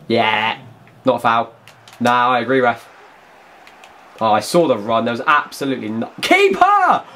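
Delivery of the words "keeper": very high, loud cry of keeper; sudden shout. Real, not acted. Gamer suddenly yelling for his keeper mid-sentence, a sharp shout. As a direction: yell it as the danger appears.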